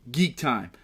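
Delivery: ordinary delivery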